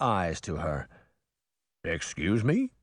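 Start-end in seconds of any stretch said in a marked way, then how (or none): 1.8-2.7 bubbly, watery fish-man voice; gurgling fish creature. A narrator's reading. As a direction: gurgle it like a fish-faced guardian. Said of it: "the fish-faced guardian gurgled".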